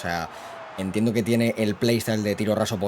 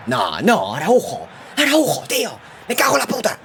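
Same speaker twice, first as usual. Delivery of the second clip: high, loud angry shout; furious; 10 semitones higher, 4 dB louder than usual. Real, not acted. Cursing Araujo for giving away a penalty, furious shout. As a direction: yell in outrage at the defender.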